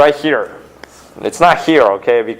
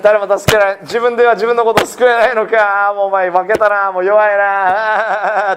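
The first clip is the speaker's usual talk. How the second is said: higher, sneering taunt in a put-on voice; mocking jeer; 5 semitones higher than usual. Crowd mocking Jesus on the cross, sneering taunt. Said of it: "わーってあざけってます".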